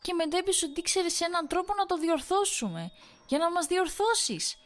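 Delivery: very high, loud frantic shriek; hysterical screaming. A narrator's reading. Wife screaming at her husband about the night. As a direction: shriek it all out at him.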